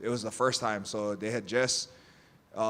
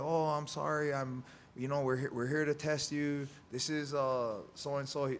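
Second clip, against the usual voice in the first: lightly raised, put-on female voice; imitated apologetic tester; 4 semitones higher than usual. Tester apologizing to annoyed athlete, higher imitated voice. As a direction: mimic her apology a bit higher. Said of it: "She's like".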